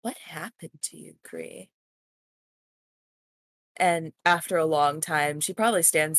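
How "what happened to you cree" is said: mumbling to herself. Acted. Yorgia to herself after watching Cree leave, mumbling. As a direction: trail down into a quiet mumble. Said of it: "mumbles to herself".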